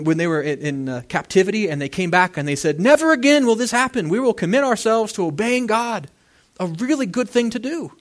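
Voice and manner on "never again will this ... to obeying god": loud, raised-pitch declaration; emphatic acted pledge; resolute vow. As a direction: proclaim it like a people swearing an oath. Acts out returned exiles' solemn vow.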